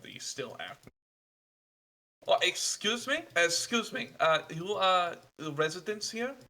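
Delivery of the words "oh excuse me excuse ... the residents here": put-on higher, eager young traveler startling the locals; a polite young stranger, apologetic and a little breathless; nervous young traveler. GM voices traveler NPC.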